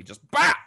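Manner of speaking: sharp loud bah; slap sound effect. Retold or imitated. Brother reliving his sister's slap, a loud sharp bah. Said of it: "imitating the slap".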